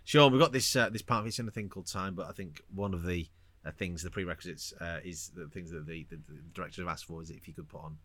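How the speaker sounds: low, quiet, tentative agent pitching a part; mild agent act, hesitant and hushed; lightly voiced agent. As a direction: hedge it softly, trailing down. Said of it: "Sean Bean's agent has gone".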